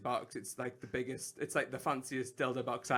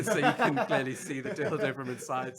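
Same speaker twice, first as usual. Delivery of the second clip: laughing; 9 dB louder than usual. Real, not acted. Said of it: "laughing while reading".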